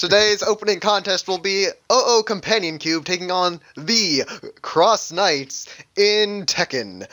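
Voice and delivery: hyped fight-card announcer; mock announcer. Introducing a Tekken contest between co-hosts, hammed-up announcer. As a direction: announce the match with big pauses and punched names.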